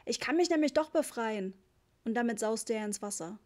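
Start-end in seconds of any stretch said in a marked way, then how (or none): 0.1-1.5 put-on high-pitched child's crowing; shouted boast. A narrator's reading. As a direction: call it out as a triumphant little boy. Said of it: "schrie er". (kindlich hoher, triumphierender Ruf)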